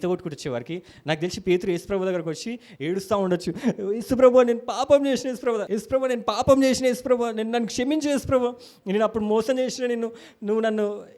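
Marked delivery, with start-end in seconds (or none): 3.9-11.0 crying plea. Retold or imitated. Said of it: "అని ఏడుస్తూ ఉండొచ్చు".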